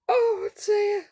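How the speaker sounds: usual speaking voice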